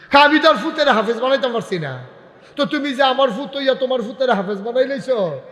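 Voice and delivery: high, loud, quick objection; acted heated protest; father's protest. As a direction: argue back heatedly as the father.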